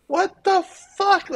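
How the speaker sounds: very high, drawn-out, slow what the fuck; stunned disbelief. Retold or imitated. Drummer retelling his shock at the band offer, very high and slow. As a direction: let the shock crack the voice upward. Shocked at being asked to join.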